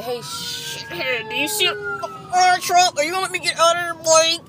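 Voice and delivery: mock driver shout.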